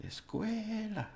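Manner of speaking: escuela with a long, high middle syllable; over-pronounced stress. Shown as a demonstration. Teacher showing how you get the stress, exaggerated middle syllable. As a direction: stretch and lift the "cue" in escuela. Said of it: "when you overpronounce the stress part".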